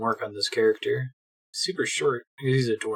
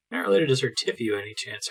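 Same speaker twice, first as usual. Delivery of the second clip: ordinary talk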